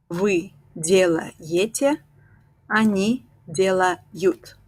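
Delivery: slow, careful conjugation, each form spaced; slow drill. Shown as a demonstration. Teacher drills the class slowly through each form. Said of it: "slow conjugation drill".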